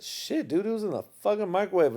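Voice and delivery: high, quick mock wife voice; a lightly voiced wife setting him straight; imitating wife. Wife telling him the food was in the microwave, high and quick. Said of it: "but she said".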